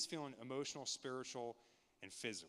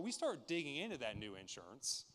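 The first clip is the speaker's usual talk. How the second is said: ordinary delivery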